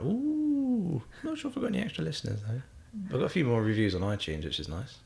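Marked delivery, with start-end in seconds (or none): 0.0-2.6 high, fast coy aside; mock modest. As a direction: fake surprised humility. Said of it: "So you're like".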